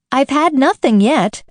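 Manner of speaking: Alice offended by the March Hare. Acted. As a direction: sound a little affronted. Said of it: "in an offended tone".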